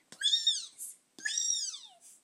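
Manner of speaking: very high, tiny pleading squeak; little voice. Acted. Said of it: "said a little voice".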